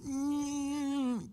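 high, soft strained hum; imitated struggling. Preacher imitates woman struggling to answer him, strained. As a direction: imitate a long stifled effort to speak. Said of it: "She tries and struggles and struggles".

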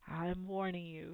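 soft, slow menace; quietly threaten, word by word. A narrator's reading. Uncle threatens boy up close.

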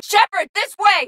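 high, drawn-out call to a squadmate; loud, high-pitched call across the battlefield; urgent combat shout. Acted. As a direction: shout urgently to get their attention. Calling out in combat.

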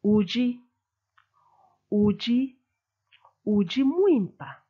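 Uji with the curve on the U, rising then falling; Chiluba he is. Shown as a demonstration. Teacher shows the stroke on the U in Uji. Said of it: "this is what it sounds like".

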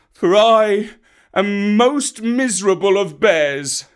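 high, loud, despairing wail; wailing bear. A narrator's reading. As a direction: wail in misery for the lost cub.

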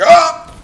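high, loud yelp; imitated pain yelp. Player showing viewers how the shock felt, mock yelp. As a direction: act out the jolt with a yelp. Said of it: "right on the pressure point".

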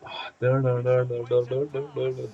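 crazy babble, slow and high; wild unhinged ranting. Pretend. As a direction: play the madman, babbling nonsense. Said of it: "Deli taklidi yaparken ben". (deli taklidi yaparak)

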